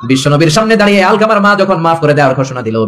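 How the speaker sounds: normal talking voice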